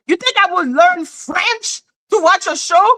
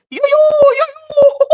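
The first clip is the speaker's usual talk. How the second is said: very high, loud hooting parody; mocking how they sound; 12 semitones higher, 4 dB louder than usual. Showing how they sound to her, high loud mockery. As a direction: hoot it like a silly mangled accent. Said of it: "that's how they sound to me".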